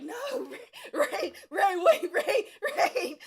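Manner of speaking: high, loud laughing plea; pleading friends. Retold or imitated. Classmates restraining him, laughing high pleas. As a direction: call his name over and over, cracking up.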